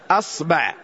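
أصبع said "as-ba'", a in both syllables; fatha on both. Shown as a demonstration. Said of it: "نفتح الباء مع الهمزة، فنقول".

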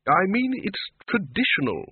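high-pitched, snappish retort. A narrator's reading. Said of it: "the Arch-Chancellor snapped".

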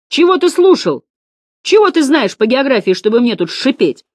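high, loud, indignant challenge; outraged teacher's questions; angry berating. A narrator's reading.

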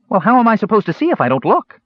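high-pitched protest; indignant kender. A narrator's reading. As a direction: complain back in a high little voice.

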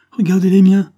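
high, very loud outburst; impassioned cry. Acted. Chiffon answering the man's talk of rags, crying out. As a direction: cry it out in protest. Child cries out in argument.

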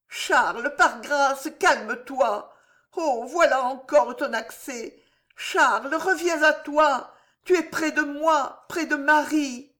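high, urgent woman's voice, rising as she begs; pleading mistress. Acted. Marie begging the king to come back to her, high and urgent. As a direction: call him back to himself, tender but urgent. Marie calms Charles again.

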